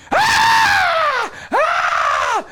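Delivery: loud, very high mock wail; imitated baby scream. Father imitating his screaming baby boy, loud high scream. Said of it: "baby boy starts screaming".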